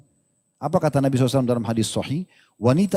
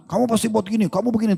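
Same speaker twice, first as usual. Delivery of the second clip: accusing wife; 6 semitones higher than usual. Acted. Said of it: "kalau tiba-tiba istri bilang".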